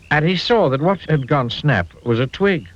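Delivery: ordinary delivery, nothing marked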